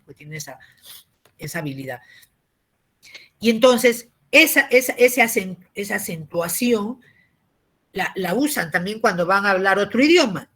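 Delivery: usual speaking voice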